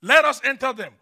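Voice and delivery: begging demons. Retold or imitated. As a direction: cry out the demons' request forcefully. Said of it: "The demons begged him".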